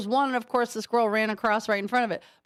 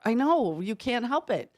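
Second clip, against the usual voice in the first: ordinary talk, nothing marked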